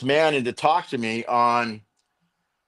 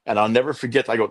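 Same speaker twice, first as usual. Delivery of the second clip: ordinary talk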